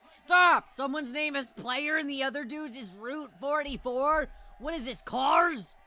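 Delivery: loud, high outburst of disbelief; outraged, drawn-out punch at the end; incredulous shouting. Real, not acted. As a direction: yell it like you can't believe it. Streamer outraged at silly names.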